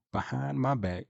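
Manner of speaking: normal talking voice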